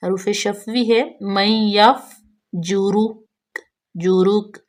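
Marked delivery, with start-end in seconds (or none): 1.2-3.2 drawn-out recitation, very slow and clear. Shown as a demonstration. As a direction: stretch each syllable like teaching recitation. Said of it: "teacher models recitation". (بہت آہستہ تلاوت)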